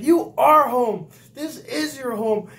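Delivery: slow, very loud, high bellow; chief yelling. Retold or imitated. Chief instructor bellowing at a leaving recruit: slow high yell. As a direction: hammer each word like an outraged chief.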